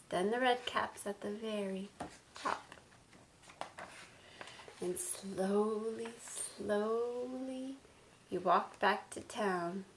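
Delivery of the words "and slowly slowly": soft, drawn-out storytelling; slow. A narrator's reading. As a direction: stretch each "slowly" out gently. Acting out the slow walk.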